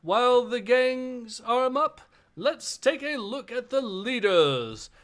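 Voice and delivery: lightly voiced arena announcer. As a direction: present the fighters like a show host. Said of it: "I'll do the voice of the announcer here".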